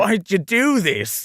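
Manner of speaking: high, drawn-out pleading line read; hammed-up slow, high plea; acted plea. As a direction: stretch it out, pitched high.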